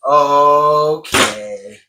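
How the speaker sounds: loud, drawn-out rising okay; overwhelmed okay. Retold or imitated. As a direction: let the surge out on one long high word. Acts out emotional surge.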